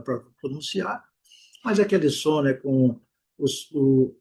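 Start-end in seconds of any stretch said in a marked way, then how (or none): none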